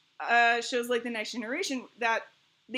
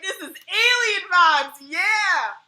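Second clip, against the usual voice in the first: high, loud, slow gleeful cry; hammed-up excited whoop; thrilled cheer; 7 semitones higher, 8 dB louder, much slower than usual. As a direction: cheer on the heroine. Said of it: "and I was like".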